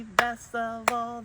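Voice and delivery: usual speaking voice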